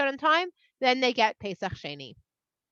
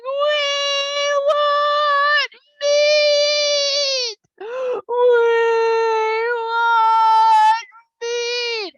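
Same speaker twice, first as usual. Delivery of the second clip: very high, loud, drawn-out bawling; crying; 11 semitones higher, 9 dB louder, much slower than usual. Acted. People bawling all night for meat, long loud wails. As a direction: wail it out like sobbing toddlers. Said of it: "they're crying".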